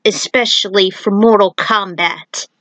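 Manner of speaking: ordinary delivery, nothing marked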